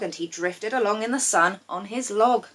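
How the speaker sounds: ordinary delivery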